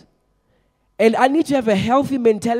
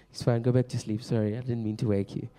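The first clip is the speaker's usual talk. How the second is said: mock hushed apology; 8 semitones lower, 10 dB quieter than usual. Joking to a sleeping listener.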